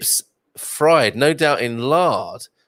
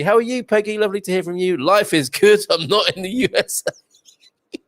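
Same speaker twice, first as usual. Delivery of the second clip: raised, lightly put-on voice; a light hint of a Peggy impression; mock Peggy voice; 8 semitones higher than usual. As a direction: read the comment as Peggy. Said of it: "terrible impersonation".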